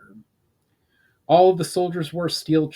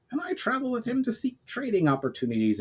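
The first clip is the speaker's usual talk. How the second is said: goblin traveler; 11 semitones higher, faster than usual. Acted. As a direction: pitch it up, keep it sly and small. Urgar answers the soldier.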